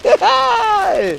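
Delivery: high, loud shout of joy; excited shout. Acted. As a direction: cry out thrilled at the gift. Overjoyed at receiving a gift.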